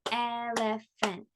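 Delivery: "Elephant" slowly in three beats el, e, phant; clapped-out syllables. Shown as a demonstration. Teacher asks how many syllables, "Elephant" slowly broken up. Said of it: "How many syllables?".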